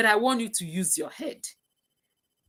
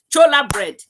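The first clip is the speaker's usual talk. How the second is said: loud, higher burst of remembering; excited recall; 5 semitones higher, 11 dB louder than usual. Real, not acted. Host suddenly remembers the bread's name, loud excited burst. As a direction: blurt the name as it finally comes back.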